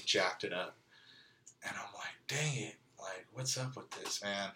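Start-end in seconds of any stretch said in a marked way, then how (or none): 2.3-4.5 hushed, whispered grumble to himself; frustrated whisper. Retold or imitated. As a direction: mutter it under your breath, fed up. Retold frustration over the stolen truck.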